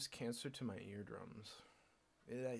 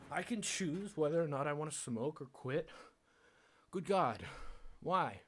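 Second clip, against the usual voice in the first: loud, higher exasperated outburst; frustrated rant; 3 semitones higher, 5 dB louder than usual. Real, not acted. Vlogger ranting at everyone who tells him to quit, loud. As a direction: vent it loudly, fed up. Ranting about his smoking.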